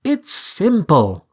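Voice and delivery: high scheming talking bird; persuasive bird. A narrator's reading. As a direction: coax him with sly reasoning. Bird character voice.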